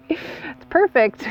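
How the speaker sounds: high giggle spilling into slow words; giggle, then say it high through the laughter; real delighted giggle. Real, not acted. Host tickled by the bee in the plant, delighted giggle.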